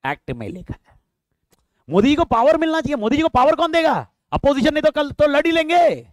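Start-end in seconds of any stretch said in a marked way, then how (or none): 1.9-6.1 raised pitch, louder, driving the point home; teacher pressing a point at full voice; emphatic raised voice. Shown as a demonstration. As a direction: push the argument up and out. Teacher's raised rhetorical build-up.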